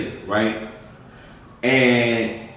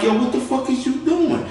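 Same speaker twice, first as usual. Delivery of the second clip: shocked question; 12 semitones higher than usual. Retold or imitated. Young narrator confronting friend with drugs.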